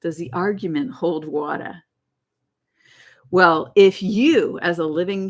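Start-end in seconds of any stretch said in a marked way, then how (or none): 0.0-1.8 playful imitation of a New York accent. Quoting Lisa from My Cousin Vinny, imitated New York accent. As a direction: put on a movie character's New York accent.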